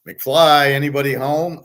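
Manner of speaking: high, loud sarcastic call; mocking taunt. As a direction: knock on an empty head, jeering. Mocking the TV hosts.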